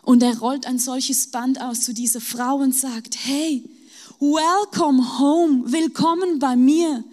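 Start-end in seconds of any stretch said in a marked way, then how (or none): 3.3-7.0 slow, louder, open-armed welcome; welcoming Jesus. Acted. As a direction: call her home warmly and slowly. Jesus invites a grieving widow. (langsamer, lauter, herzlicher Willkommensruf)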